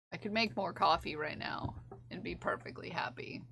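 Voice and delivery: hushed whisper, barely voiced. Shown as a demonstration. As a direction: whisper it like a secret.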